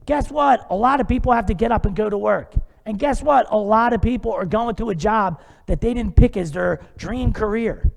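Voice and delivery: ordinary talk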